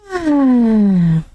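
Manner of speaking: long, drawn-out falling sigh; exasperated sigh. Real, not acted. Host done ranting at the man, exasperated sigh. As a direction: let out a long sigh that sinks down. Long groan after her rant.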